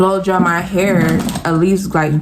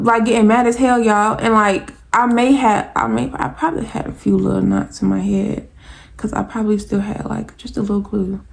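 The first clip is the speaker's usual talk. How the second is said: ordinary delivery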